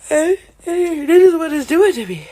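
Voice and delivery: high, loud mock exclamation; high loud exclaim. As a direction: throw up a high startled shout. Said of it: "And I realized".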